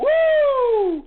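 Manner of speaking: loud high woo of triumph; high celebratory whoop. Real, not acted. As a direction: let out a victory whoop.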